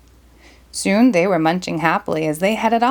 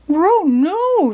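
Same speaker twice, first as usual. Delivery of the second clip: much higher scared dog cry; Scooby yelling; 9 semitones higher than usual. Acted. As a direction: yelp in fright like a cartoon dog. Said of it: "Scooby yelled".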